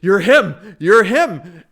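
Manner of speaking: loud, high accusing cry; mock panicked shout. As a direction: yell it like you recognise your torturer. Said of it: "shaking and having a panic attack".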